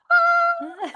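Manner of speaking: high squealing laugh burst; a sudden shrieked laugh; mock laughing shriek. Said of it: "she's going".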